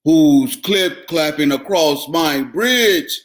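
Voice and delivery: troll growling at the goat; roaring troll. Acted. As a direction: menace from under the bridge. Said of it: "A roar rose up".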